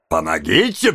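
low, jeering echo; mocking repeat. A narrator's reading. Man echoing the boy's plea, mocking. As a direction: throw the plea back in a low voice. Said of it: "повторил человек".

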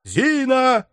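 high call for the maid; calling shout. A narrator's reading. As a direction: shout for her. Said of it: "кричал Барменталь".

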